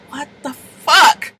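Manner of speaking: high, drawn-out mock astonishment; stunned disbelief. Kids on the line hearing the phone ring, stunned disbelief. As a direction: stretch it out in shock.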